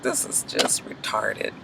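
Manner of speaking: soft whisper, slow and unvoiced; whispered aside. Real, not acted. Vlogger whispering her verdict on the show's drama to viewers. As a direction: breathe it as a private aside.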